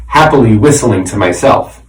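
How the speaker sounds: usual speaking voice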